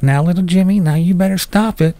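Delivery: mock a lenient parent gently warning a child; lightly put-on pleading parent voice. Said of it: "Now it's like".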